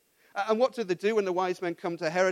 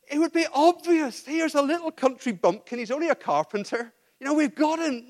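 higher, scornful put-on sneer; mocking the priests; 7 semitones higher, 4 dB louder than usual. Preacher voicing the priests dismissing Jesus, scornful higher sneer. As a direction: sneer down at the country bumpkin.

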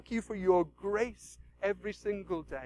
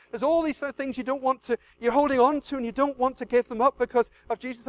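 ordinary talk

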